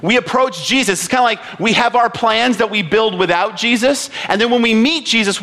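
ordinary talk, nothing marked

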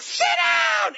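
very high, loud yelled command; shouted order. Retold or imitated. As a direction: bark it at the class like a furious vice principal. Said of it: "she's like".

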